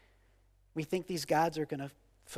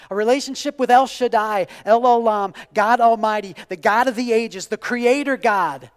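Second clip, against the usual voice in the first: loud, ringing list of God's names; preaching at full force; 5 semitones higher, 12 dB louder than usual. Acted. Preacher declares God's names to the church, booming. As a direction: proclaim each name louder and prouder.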